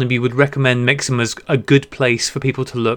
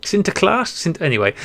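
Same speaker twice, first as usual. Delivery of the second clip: usual speaking voice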